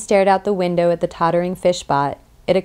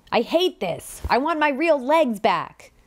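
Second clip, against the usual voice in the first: frustrated outburst; 8 semitones higher than usual. A narrator's reading. Skinner cursing his new legs as he prances behind the clones, frustrated outburst. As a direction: wail it in exasperation, pitch climbing.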